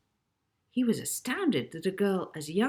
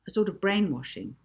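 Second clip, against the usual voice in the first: ordinary delivery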